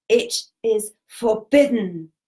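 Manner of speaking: loud, slow booming command; huge bellow. Retold or imitated. Spirit guide banishing an intruding spirit, bellowing. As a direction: bellow it as a mighty guardian, imitated. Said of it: "he bellowed with this huge voice".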